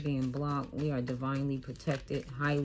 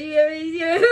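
ordinary delivery

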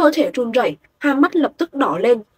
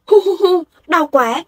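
put-on higher, louder sobbing little girl; child sobbing in pain; 6 semitones higher, 4 dB louder than usual. A narrator's reading. Beaten little girl cries to the bullies, sobbing in pain. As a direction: whimper and wail from the hurt. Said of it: "đau đến nước mắt chảy ròng".

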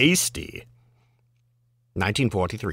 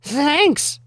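high-pitched little squeal of a word; young girl's voice. Acted. Reader voicing the girl in the limerick, high girlish voice. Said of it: "heard a young girl murmur".

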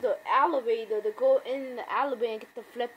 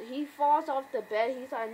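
ordinary talk, nothing marked

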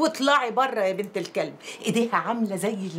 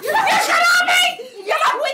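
much higher, louder cries through helpless laughter; laughing shriek; 7 dB louder, slower than usual. Acted. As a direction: shriek it while doubled over laughing. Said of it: "بتضحكي يا ولية". (صريخ وسط الضحك)

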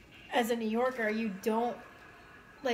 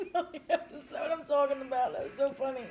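talking through real laughter; laughing; 3 semitones higher than usual. Real, not acted. Recalling a funny episode, laughing as she talks. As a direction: let the laugh carry through the words. Laughing after her impression.

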